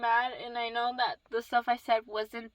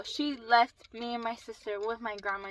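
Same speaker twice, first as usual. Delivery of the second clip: ordinary talk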